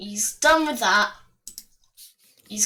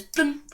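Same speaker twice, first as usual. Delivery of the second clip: short higher plop; imitated bloop; 4 semitones higher than usual. Narrator mimics a Koopa Trooper dropping, short high bloop. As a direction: pop out a little falling-turtle noise. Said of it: "and this one goes".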